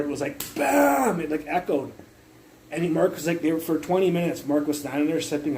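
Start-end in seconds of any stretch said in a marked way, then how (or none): 0.5-1.2 long, high "bam" sound effect; imitated impact. Telling how hard the block hit, long high imitated bang. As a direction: draw out a high-pitched mock impact. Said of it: "I hit it so hard".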